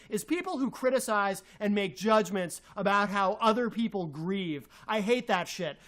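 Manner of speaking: ordinary talk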